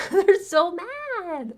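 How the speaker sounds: high, drawn-out MAD after a laugh; mocking squeal. Repeats it in mocking glee.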